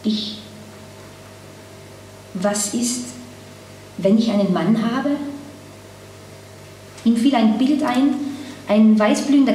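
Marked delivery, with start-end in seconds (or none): 0.0-5.1 hesitant, hushed question with long pauses; whispered. A narrator's reading. Woman confides a fear to the man at dinner, whispered. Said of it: "Dann flüsterte sie".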